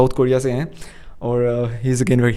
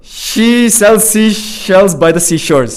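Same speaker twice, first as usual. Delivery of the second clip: drawn-out, higher-pitched recital; very slow attempt; 6 semitones higher, 4 dB louder, much slower than usual. Shown as a demonstration. Host takes up the challenge, very slow careful attempt. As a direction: stretch every word of the twister.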